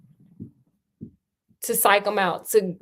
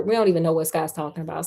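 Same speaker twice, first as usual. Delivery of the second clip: normal talking voice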